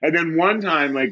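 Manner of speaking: ordinary talk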